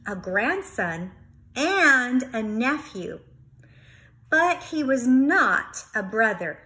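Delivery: plain reading voice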